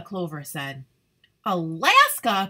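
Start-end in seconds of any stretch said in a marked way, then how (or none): 1.5-2.5 loud delighted exclamation; excited cry. Acted. Sunny hears about the trip to Alaska, crying out loudly. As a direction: burst out with joy. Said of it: "cried Sunny".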